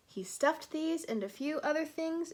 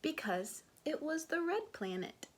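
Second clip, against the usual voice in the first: usual speaking voice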